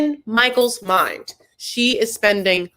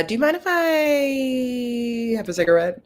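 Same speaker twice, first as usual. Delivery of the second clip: drawn-out, higher-pitched tentative ask; hesitant request; 5 semitones higher than usual. Acted. Passenger asking the Uber driver if smoking is okay, tentative. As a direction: stretch it out as you feel out the driver.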